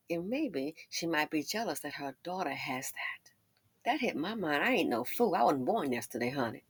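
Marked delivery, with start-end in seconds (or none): 0.1-3.3 soft confiding whisper. Real, not acted. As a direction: whisper it like a secret. Aside in a whisper.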